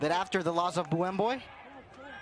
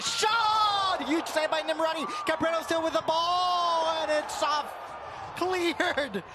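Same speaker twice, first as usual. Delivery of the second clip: excited call; 9 semitones higher, slower than usual. Real, not acted. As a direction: shout the save live. Big save called out.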